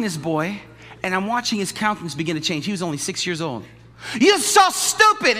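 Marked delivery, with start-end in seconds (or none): 4.2-5.4 high, loud, slow mock yelling; yelling mother. Korean mother yelling at her son, mock yelling. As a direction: berate him with a laugh in it. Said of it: "yelling at him in front".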